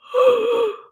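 sharp high gasp of dismay; playful horrified gasp; mock shocked gasp. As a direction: gasp like you've heard bad news. Told to meditate an hour.

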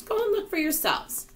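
high-pitched invitation; confident reassurance. Acted. James tells doubters to check, confident reassurance. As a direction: send them off in a high, sure voice.

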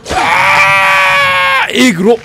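long startled scream of disgust; a real drawn-out yell at the gore. Real, not acted. Jump scare in horror game. (長い悲鳴)

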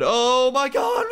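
long high-pitched wail of alarm; panicked cry. Real, not acted. Player failing to dodge, high drawn-out panic. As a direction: cry out slowly as disaster hits. Hit by obstacle in game.

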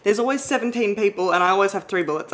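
venting real frustration, voice pushed up; getting mad. Real, not acted. Gamer ranting at the game about seventeen enemies, fed up and loud. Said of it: "I'm getting mad".

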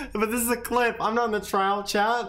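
ordinary talk

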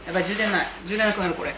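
normal talking voice

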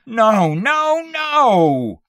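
loud, high drawn-out wail. A narrator's reading. Husband bursting into tears after the scare, wailing. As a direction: wail in helpless distress. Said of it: "he wailed".

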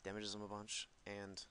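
ordinary talk, nothing marked

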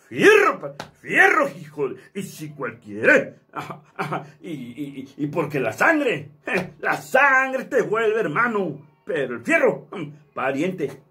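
drawled northern boss voice, punched "fierro"; godfather voice. Shown as a demonstration. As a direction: lean back and growl like a cartel padrino. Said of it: "Así más o menos habla el padrino".

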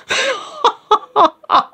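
very high, helpless cackle; real laughing fit. Real, not acted. Co-host cracks up over sounding like a lunatic, high laughing fit. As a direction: break up in high-pitched laughter. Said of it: "Stop laughing".

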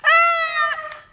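sudden high loud squeal; weird yelp. Real, not acted. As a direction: let out a strange shriek. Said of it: "that was a weird noise".

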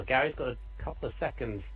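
usual speaking voice

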